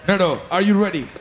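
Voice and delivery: usual speaking voice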